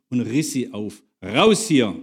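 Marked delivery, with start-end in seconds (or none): 1.2-1.9 commanding. A narrator's reading. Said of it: "befahl ich dem Gefangenen".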